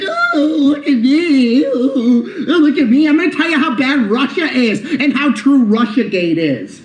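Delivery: high, mocking girly-girl voice; David Pakman. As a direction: mimic him as a simpering little girl. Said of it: "He talks like a little fucking girly girl".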